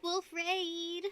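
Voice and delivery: ordinary talk, nothing marked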